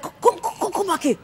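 high-pitched acted summons; mock urgent, beckoning someone to return; urgent calling back. Imitating a dad calling his son back, high and urgent.

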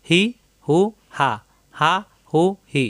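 হি, হু, হা as short separate syllables; short open h sounds, no throat pressure; quick open ha drill. Shown as a demonstration. Teacher drilling round ha for students, short open syllables. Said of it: "দ্রুত উচ্চারণ করতে হবে".

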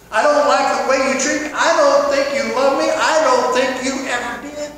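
guilt-trip your spouse out loud; whiny accusation. Acted. Spouse nagging their partner, loud accusing whine. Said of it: "that's emotional manipulation".

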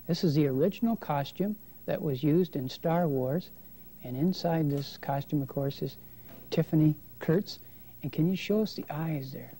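ordinary delivery

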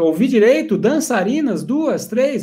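high-pitched, incredulous delighted questions; crazily excited. Acted. Slave hears of dancing girls awaiting him, crazily excited. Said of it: "O escravo tá doido".

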